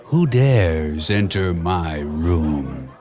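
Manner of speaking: put-on low, slow Spanish-accented menace; Spanish-accented hiss. A narrator's reading. Hidden cat answering the king, Spanish-accented and low. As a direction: lurk in the shadows, Spanish accent. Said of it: "A Spanish-accented voice hissed".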